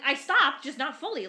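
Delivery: retold annoyance, punched up at the start; annoyed protest. Driver defending the rolling stop to cop, annoyed. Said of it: "now I'm annoyed".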